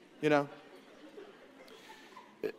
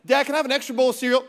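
put-on fast, higher, louder kid voice; kid begging dad; 6 semitones higher, 5 dB louder, much faster than usual. Kid asking dad for more cereal, quick eager plea. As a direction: rush the ask like an eager child.

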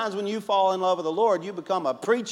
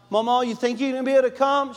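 eager phone call; 9 semitones higher, 5 dB louder, faster than usual. Retold or imitated. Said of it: "So I kept calling her".

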